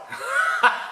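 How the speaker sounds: a high, airless burst of laughter; sudden high laugh; breathy laugh. Real, not acted. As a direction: laugh it out in disbelief. Amused at new mob turning up.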